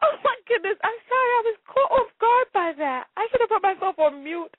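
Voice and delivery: helpless real laughter, voice squeaking up high; laughing hard. Real, not acted. Host caught off guard by a listener's comment, laughing helplessly. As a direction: gasp out high-pitched words through uncontrollable laughter. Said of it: "I'm laughing so hard".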